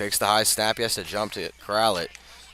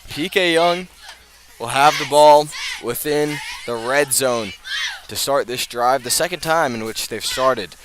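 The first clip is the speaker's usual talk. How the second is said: higher and louder, driving the call; excited play-by-play; 4 semitones higher, 7 dB louder than usual. Real, not acted. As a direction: pump up the crowd about the red zone. Turnover, ball in red zone.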